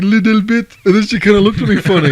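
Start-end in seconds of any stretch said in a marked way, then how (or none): 0.0-0.8 put-on heavy French accent, pitched up; mock French-accented English. Retelling his answer in a French accent. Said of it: "I was like".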